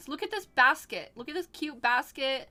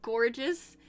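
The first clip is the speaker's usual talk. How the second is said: ordinary talk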